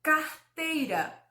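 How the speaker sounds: "Carteira" slow, with a gap after car; very clear. Shown as a demonstration. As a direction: articulate "Carteira" slowly and distinctly. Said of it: "falei de forma muito clara". ("Carteira" de forma muito clara, devagar)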